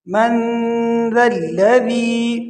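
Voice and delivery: slow من ذا الذی with a long nasal n; nasal ghunna. Shown as a demonstration. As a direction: hum the ن of من before ذا. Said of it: "نون غنہ کریں گے". (من کے نون پر غنہ)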